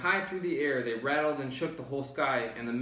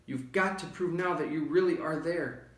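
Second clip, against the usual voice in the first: high, urgent pleading call; rally him before it's too late; 7 semitones higher than usual. A narrator's reading. Elephant urging the mayor to rally everyone, high and pressing. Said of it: "called Horton".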